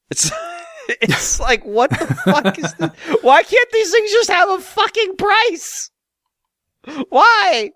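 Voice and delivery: high, laughing outrage at the shop; laughing rant. Real, not acted. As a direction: squeal the complaint through laughter. Laughing rant about prices.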